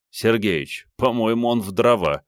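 usual speaking voice

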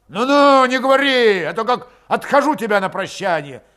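high, loud warning; angry threat. A narrator's reading. Threatening a parting thrashing in the quarrel, furious.

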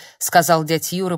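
ordinary delivery, nothing marked

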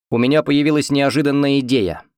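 usual speaking voice

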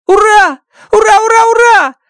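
high, loud alien cheers of joy; Rocky cheering his friend's decision; joyful cheering. Acted. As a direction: cheer like a thrilled little alien. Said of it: "Рокки на мгновение умолкает".

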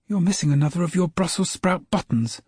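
high, lightly voiced boy; a child pointing something out; young boy voice. A narrator's reading. James to snowman.

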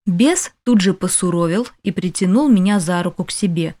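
ordinary delivery, nothing marked

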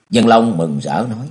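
ordinary delivery, nothing marked

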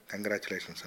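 softly, with a touch of nervousness; understated, hesitant congratulations; timid. A narrator's reading. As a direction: offer it meekly, dropping the voice. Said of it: "బెదురుగా".

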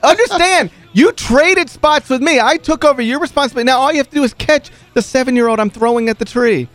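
high-pitched, indignant telling-off; retold rant, voice climbing in disbelief; exasperated scolding. Glaring at the catcher who dropped her job, exasperated scolding.

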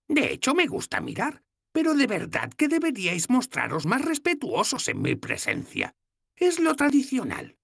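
voiced little old woman, amused; old goddess's voice. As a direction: tease the kissing couple.

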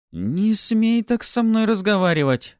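high, loud, outraged protest; indignant exclamation. A narrator's reading. Newcomer telling Pafi off, outraged. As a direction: cry out high in offended anger. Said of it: "воскликнула новенькая".

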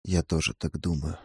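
soft, low and hushed reply; quietly. Acted. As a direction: answer quietly, barely above a breath. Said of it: "тихо ответил он". (тихо, приглушённо)

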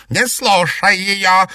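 slow, high, loud snarl; angry. A narrator's reading. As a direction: order it in anger. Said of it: "разозлилась зеркальная ведьма".

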